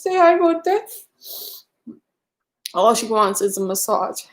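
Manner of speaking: high cheerful cooing greetings; pet talk greetings. Real, not acted. Greeting viewers by name with the dog, high cooing.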